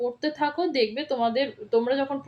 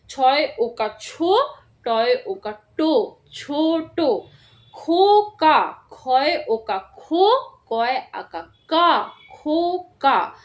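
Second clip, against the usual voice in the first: slow spelling out; slower than usual. Shown as a demonstration. Teacher spelling out a word slowly for first graders. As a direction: spell each letter slowly and clearly. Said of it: "এরম করে তোমরা যদি পড়ো".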